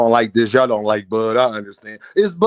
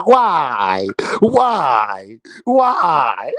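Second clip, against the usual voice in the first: laughing cry; 3 semitones lower, much slower than usual. Real, not acted. Crying out between laughs.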